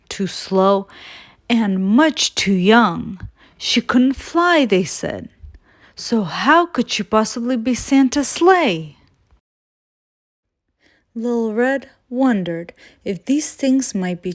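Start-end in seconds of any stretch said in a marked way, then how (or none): none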